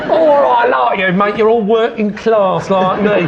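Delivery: chummy northern builder banter. Retold or imitated. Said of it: "I'd start doing my usual of getting more northern".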